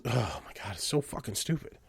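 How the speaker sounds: ordinary talk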